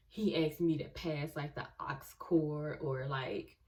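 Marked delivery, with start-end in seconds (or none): none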